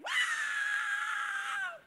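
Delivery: high, piercing mock scream like a girl; hammed-up little girl's shriek; daughter's theatrical scream in the van; dramatic scream. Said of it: "dramatic scream".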